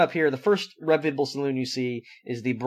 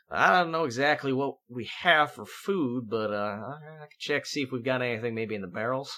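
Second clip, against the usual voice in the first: softer, hesitant barman, halting over words; embarrassed barman; 5 dB quieter than usual. Acted. Barman admits to guests there may be no food, embarrassed. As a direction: play a sheepish saloon keeper, dropping softer. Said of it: "he seems very embarrassed".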